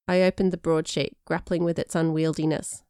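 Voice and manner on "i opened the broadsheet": usual speaking voice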